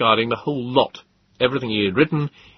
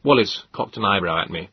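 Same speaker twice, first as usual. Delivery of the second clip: ordinary delivery, nothing marked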